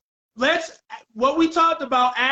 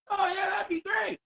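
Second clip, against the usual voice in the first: higher-pitched, gleeful exclamation; acted delight; 9 semitones higher, 5 dB quieter than usual. Dreaming of getting on TV, excited. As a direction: pipe up high with mock glee.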